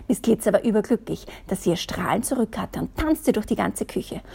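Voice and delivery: ordinary delivery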